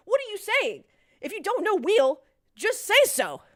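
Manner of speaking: loud, exasperated challenge; indignant clapback. Real, not acted. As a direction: throw it loudly back at the heckler. Indignant clapback at an online heckler.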